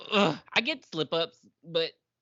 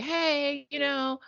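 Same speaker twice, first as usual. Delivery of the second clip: higher, hesitant put-on babysitter voice; mock babysitter voice; 8 semitones higher than usual. Recapping the show to her cohost, voicing the babysitter in a higher put-on voice. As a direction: play the fumbling babysitter, pitched up. Mimicking the babysitter's awkward intro.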